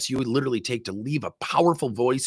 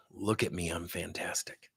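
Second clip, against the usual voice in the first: mock ego whisper; 4 semitones lower, 11 dB quieter than usual. Speaker mocks the salesman's vanity, a soft smug whisper. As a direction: whisper it like a vain boast to yourself.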